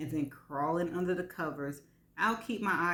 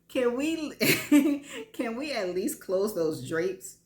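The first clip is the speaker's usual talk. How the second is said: giggling, higher, louder plea; nervous laughter; 6 semitones higher, 5 dB louder than usual. A narrator's reading. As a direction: laugh nervously as you ask.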